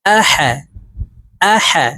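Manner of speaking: আহা with the হা from the middle of the throat. Shown as a demonstration. Teacher shows the throat হা to students. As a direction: breathe the হা from mid-throat. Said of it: "হার উচ্চারণ হলকের মধ্যখান থেকেই করতে হবে".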